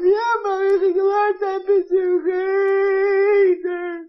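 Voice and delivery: put-on high, slow sobbing boy; a little boy admitting it in tears; child sobbing yes to mom; crying child.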